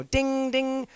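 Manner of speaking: high, sung-out bell imitation; imitated bell dings. Shown as a demonstration. Teacher describing a bell as attention signal, high imitated dings. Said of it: "I've even seen the bell".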